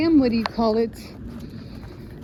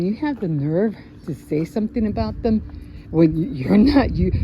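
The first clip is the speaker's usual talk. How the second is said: usual speaking voice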